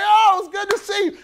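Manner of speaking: high, loud welcoming cheer; mock excited greeting. Friends at the party cheer his arrival, excited. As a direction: greet him like the life of the party. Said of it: "they be like".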